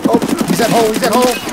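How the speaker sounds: high, loud enemy callout; urgent callout. Real, not acted. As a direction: shout the position to your teammate. Enemy spotted, calling position.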